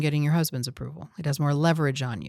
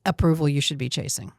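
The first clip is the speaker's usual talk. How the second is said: ordinary delivery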